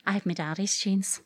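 ordinary delivery, nothing marked

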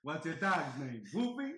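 loud, slow bellowed Terminator line; hammy cyborg asking about the dog; mock Arnold voice. Arnold line performed again.

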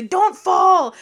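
high, loud mock panic; girls yelling warning. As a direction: shout it like the girls on the carriage. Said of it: "they say".

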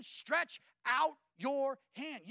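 a slow, deliberate command from Jesus. Retold or imitated. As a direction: stretch out your hand said very slowly. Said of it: "He said to the man".

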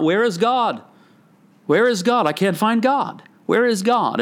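high, desperate calls of a madman; crying out. Retold or imitated. Retelling Nietzsche's madman crying in the village. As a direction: call out searching for God, pitch lifted. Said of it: "he's crying out".

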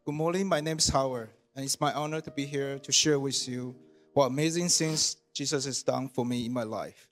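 usual speaking voice

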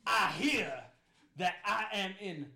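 loud, high, slow pompous lawman; sheriff Buford T. Justice. Acted. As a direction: drawl it out like a self-important sheriff. GM voices the sheriff arriving.

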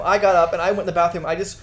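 normal talking voice

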